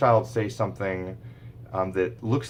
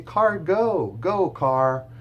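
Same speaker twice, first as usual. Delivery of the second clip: high, louder sing-song; excited modelling to a toddler; playful parentese; 9 semitones higher, 5 dB louder, much slower than usual. Shown as a demonstration. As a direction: make it fun and bouncy for the child. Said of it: "I might say".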